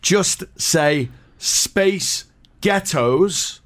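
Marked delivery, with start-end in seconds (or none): 1.4-3.6 slow, deliberate American pronunciation; American accent demo. Shown as a demonstration. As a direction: Space Ghettos drawn out in an American accent. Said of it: "in an American accent".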